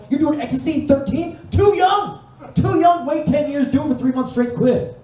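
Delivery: very high-pitched, outraged disbelief; shocked scolding. Acted. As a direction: yelp it like a scandalised guardian. Acted scolding of a thirteen-year-old.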